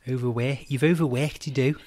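Paul Hollywood. Retold or imitated. Imitating a baker judging pastry, Liverpool accent. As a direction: exaggerate "Overworked" in mock Scouse, "work" turned to "wairk". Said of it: "I like the way that Paul Hollywood says it".

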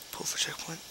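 soft whisper. Real, not acted. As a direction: whisper it to yourself. Unvoiced aside.

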